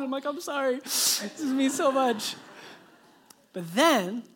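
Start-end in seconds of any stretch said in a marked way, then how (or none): 0.3-2.4 higher-pitched, mock-weepy apology; retold tearfulness. Dad crying in front of his daughter, mock tearful. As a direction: plead it high, as if welling up. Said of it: "I start crying".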